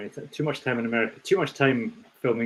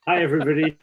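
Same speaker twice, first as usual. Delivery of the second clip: ordinary delivery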